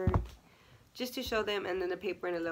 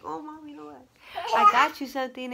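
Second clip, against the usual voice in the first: high sing-out to mom, bursting louder on the offer; playful gift announcement; 7 semitones higher, 10 dB louder than usual. Retold or imitated. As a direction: announce a surprise to mom with a laugh. Presenting the surprise gift to mom.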